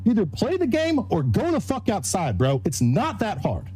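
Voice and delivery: heated, high-pitched rant; angry rant. Real, not acted. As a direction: tear into the chat.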